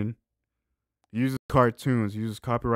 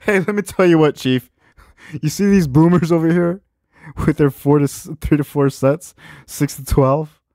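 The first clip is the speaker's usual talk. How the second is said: mocking jeer; 6 semitones higher, 7 dB louder than usual. Mocking boomers' advice.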